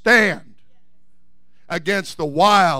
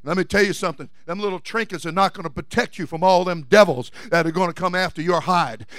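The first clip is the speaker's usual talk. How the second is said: ordinary talk, nothing marked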